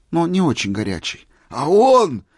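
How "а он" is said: high, loud burst; excited shout. A narrator's reading. Old man jumping up to hear more, shouting. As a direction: leap in with an eager cry. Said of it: "закричал, вскакивая".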